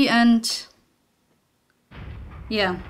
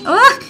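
startled yell; 11 semitones higher, 9 dB louder than usual. Real, not acted. Startled by monster.

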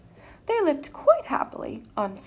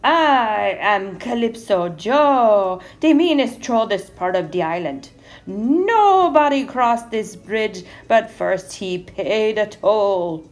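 big, slow, booming troll roar; troll's roar; 8 dB louder than usual. A narrator's reading. Mean troll warning anyone crossing his bridge, roaring slowly. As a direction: roar it out loud, stretching the words. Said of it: "roared the troll".

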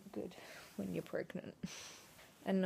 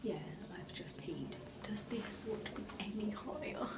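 whispering; 2 semitones higher, 9 dB quieter than usual. Real, not acted. Talking quietly while filming.

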